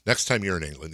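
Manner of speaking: usual speaking voice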